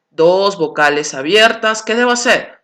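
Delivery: ordinary delivery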